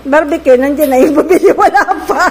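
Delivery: loud calling out through laughter; laughing loud call. Real, not acted. Calling out about barbecue buyers, laughing loudly. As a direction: laugh while shouting out.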